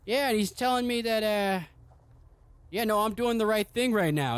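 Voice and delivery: put-on high, dotty old king voice; addled old king. Mad king explaining the skull's advice to his son, high dotty voice.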